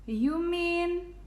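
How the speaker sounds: high-pitched astonishment; gasped. A narrator's reading. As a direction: draw it out high in amazement. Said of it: "Hardin gasped".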